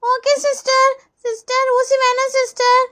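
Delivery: put-on high, slow kid plea; pleading child. Pupil begging the nurse for no injection, pleading child voice. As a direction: beg like a reluctant pupil.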